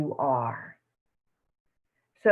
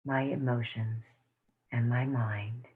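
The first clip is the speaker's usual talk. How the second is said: guided meditation; 5 semitones lower, 5 dB quieter than usual. Acted. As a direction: lead the room gently into calm. Closing a guided tapping meditation.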